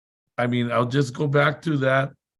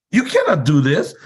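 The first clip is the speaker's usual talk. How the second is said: louder mock teller warning; loud scolding; 4 semitones higher, 6 dB louder than usual. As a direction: bark it back like a teller. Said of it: "she was so loud".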